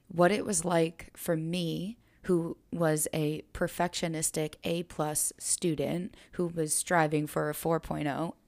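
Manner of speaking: normal talking voice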